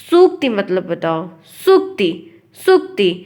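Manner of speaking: सूक्ति said clearly as "shukti"; सूक्ति as "shuk-ti", short u; correct, careful articulation. Acted. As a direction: quiz with सूक्ति begun on a distinct "sh". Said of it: "कोई सही से उच्चारण करे".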